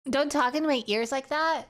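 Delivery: usual speaking voice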